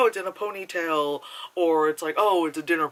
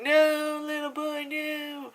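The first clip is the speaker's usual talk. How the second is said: much higher, pleading and pitying; pitying cry; 13 semitones higher, slower than usual. Pretend. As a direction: plead with the boy in a high, tender squeak. Pitying the bruised boy on screen.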